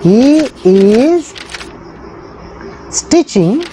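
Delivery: long gaps between the words, clothes said twice; word by word dictation. Shown as a demonstration. Teacher dictates the answer word by word to pupils. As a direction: break the answer into single words. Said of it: "teacher dictating English answer".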